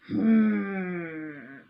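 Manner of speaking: plain reading voice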